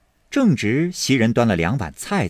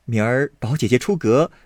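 ordinary delivery, nothing marked

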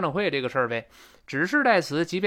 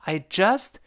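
"just" pitched up high; raised "just"; 4 semitones higher than usual. Shown as a demonstration. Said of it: "声音都得往上拔".

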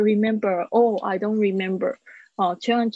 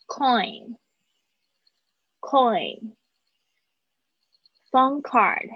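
Payphone slash public phone said slowly, stress on Payphone; slow model reading; 3 semitones higher, slower than usual. Shown as a demonstration. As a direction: read it slowly like a teacher. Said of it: "我会一个字讲两遍". (老师慢速示范朗读)

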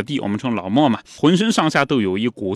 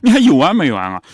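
exasperated; 3 semitones higher, 7 dB louder than usual. Retold or imitated. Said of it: "觉得自己已经被嘲笑了".

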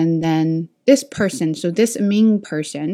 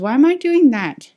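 higher-pitched retold self-challenge; self-questioning; 7 semitones higher, 4 dB louder than usual. Teacher retelling how she questioned herself, mildly exasperated. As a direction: ask yourself in mild exasperation, pitched up.

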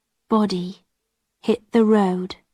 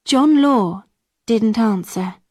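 ordinary delivery

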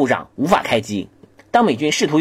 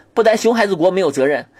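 ordinary talk

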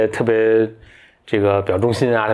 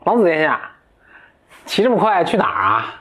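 higher, sly little dwarf calling out; dwarf's voice; 6 semitones higher than usual. Acted. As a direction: hail the prince like a cheeky dwarf. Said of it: "就，就说". (尖一点的侏儒腔)

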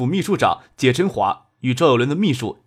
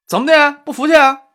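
high, loud sneering challenge; taunting shout; 12 semitones higher, 7 dB louder than usual. Acted. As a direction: throw the challenge right in his face. Thug taunting the guests.

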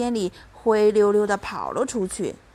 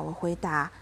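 ordinary delivery

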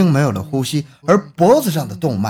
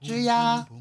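creaking door; 8 semitones higher, 8 dB quieter than usual. Acted. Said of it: "推开了房门".

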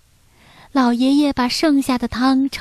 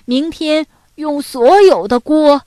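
loud, higher, delighted announcement; happy grandpa; 4 semitones higher, 7 dB louder than usual. A narrator's reading. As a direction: declare a big plan with rising glee. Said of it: "老爷爷开心地说". (老爷爷开心地高声宣布)

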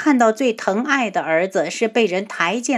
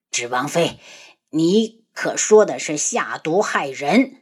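low stern challenge; 5 semitones lower than usual. Acted. Emperor challenges.

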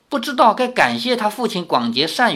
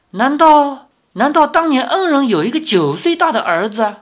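stunned disbelief; 10 semitones higher, 5 dB louder than usual. Acted. Old woman realizes a debt.

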